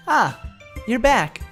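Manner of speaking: high, loud welcome in character; cheerful club-member greeting. Acted. Monika greets returning pair.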